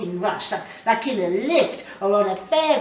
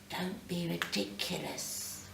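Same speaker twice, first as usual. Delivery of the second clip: soft, low telling-off to herself; self-scolding mutter; 4 semitones lower, 12 dB quieter than usual. Acted. Sandra quietly scolds herself alone in the dark room. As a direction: hush her own nerves, quietly.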